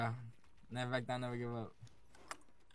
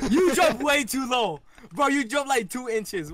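loud complaint; 12 semitones higher, 8 dB louder, much slower than usual. Real, not acted. Complaining loudly at bad jump.

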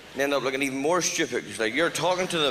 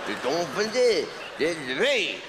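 fake French; 3 semitones higher, slower than usual. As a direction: pretend to speak French. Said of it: "I can pretend to speak French".

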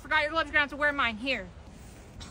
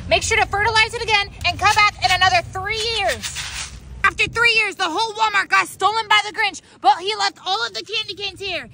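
ordinary delivery

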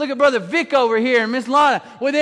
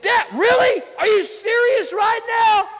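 much higher, shouted disbelief; exasperated at God; 10 semitones higher, slower than usual. Retold or imitated. Believer complaining to God, high and incredulous. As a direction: throw the question up to heaven. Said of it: "looked at your life and said".

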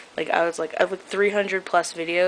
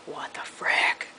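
slow shocked whisper; whispered quote; 4 dB quieter, slower than usual. Retold or imitated. Quoting friends watching the video die, slow whisper. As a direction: breathe it out, stunned. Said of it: "and they were like".

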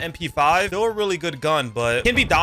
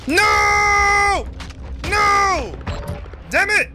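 frustrated screams; 14 semitones higher, much slower than usual. Real, not acted. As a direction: wail in real gamer frustration. Losing the round, frustrated.

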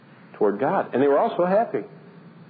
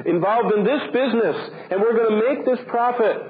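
mocking boasters' voice, much higher; boastful planners; 10 semitones higher than usual. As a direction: rattle off big plans like a smug businessman. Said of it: "He says".

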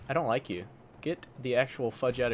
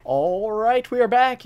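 loud, high, hyped greeting to viewers; energetic intro; 10 semitones higher, 11 dB louder, slower than usual. Real, not acted. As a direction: announce the comeback with big energy. Video intro to new map.

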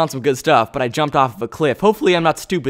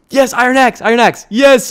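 high, excited cheer; delighted, raised-pitch exclaiming; thrilled; 7 semitones higher, 5 dB louder than usual. Real, not acted. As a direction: celebrate a lucky find. Celebrates finding an axe.

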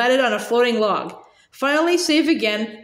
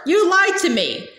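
raised pitch, indignant accusation; accusing; 6 semitones higher than usual. A narrator's reading. A frog prince accusing the girl of lying, indignant. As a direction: tell her off, voice climbing. Frogs argue.

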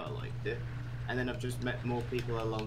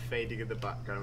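ordinary talk, nothing marked

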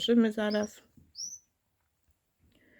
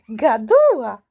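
1 loud amused exclamation; 11 dB louder, slower than usual. Real, not acted. Laughing at chatty puppy.

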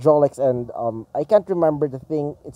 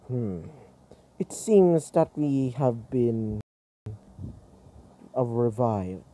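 low, slow robot voice; robot character voice; 4 semitones lower, 5 dB quieter than usual. Acted. Storyteller voicing a robot just revived, low slow character voice. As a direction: speak low and slowly, with pauses. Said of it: "He's like".

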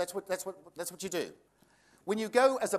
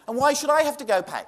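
indignant; 8 semitones higher, 8 dB louder than usual. Retold or imitated. Indignant customer.

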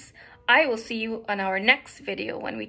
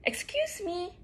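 high little bird voice; 8 semitones higher than usual. A narrator's reading. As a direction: politely pipe up in a small, high voice. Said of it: "a little voice called out".

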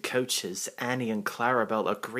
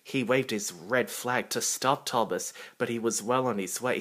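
ordinary delivery, nothing marked

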